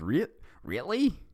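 a naive, startled question; surprised Sonic. Acted. Sonic asking Shadow, surprised and naive. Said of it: "And Sonic said".

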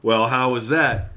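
ordinary delivery

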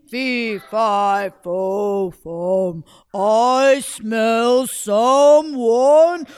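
loud, slow giant chant; booming giant. Acted. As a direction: drag out each word like a giant.

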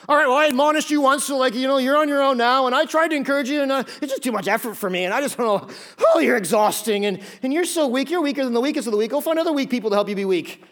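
fed up with the weak; hammed-up impatient brush-off; exasperated rant.

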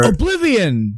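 gasp, then high, slow eureka shout; sudden realisation. Real, not acted. Host suddenly recalling the movie title, gasping eureka. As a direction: blurt out the title as it comes back to you.

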